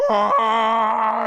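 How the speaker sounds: high, loud sustained mock yell; hammed-up battle scream; loud power-up scream. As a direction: belt out an anime charging cry. Imitates Kuu powering up.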